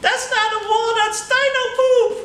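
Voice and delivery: high, loud monkey voice; gleeful monkey punchline; giggling talking monkey. Acted. Monkey telling the boy the wall is dino poop, high and loud.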